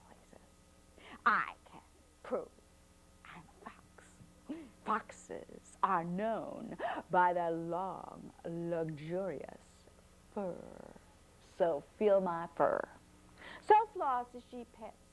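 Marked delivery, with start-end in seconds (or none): 1.2-12.9 smug fox. A narrator's reading. Fox boasts of his fur.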